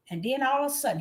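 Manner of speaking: normal talking voice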